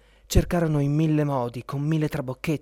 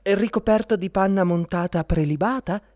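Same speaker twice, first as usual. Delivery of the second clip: higher, louder tempting lady; second court lady; 7 semitones higher, 5 dB louder than usual. Acted. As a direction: coax him with a lifted, louder voice. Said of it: "chiese la seconda".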